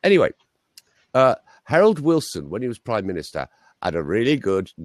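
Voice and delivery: normal talking voice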